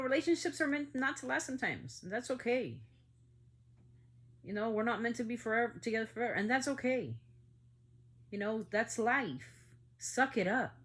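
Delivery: exasperated lecturing at the top of the voice. Real, not acted. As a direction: let the anger push the words up and out. Angry rant over child killings.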